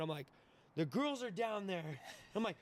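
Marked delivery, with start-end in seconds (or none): 0.8-2.0 slightly high, slowed mock-drunk alarm; hammered self, retold. Drunk man warning his friend in the elevator, mock alarm. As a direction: lightly act out a drunk man's alarm. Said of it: "I'm hammered".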